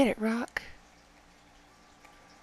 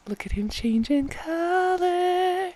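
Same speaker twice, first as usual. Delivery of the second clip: excited; 9 semitones higher, 6 dB louder, much slower than usual. Real, not acted. Thrilled at color change.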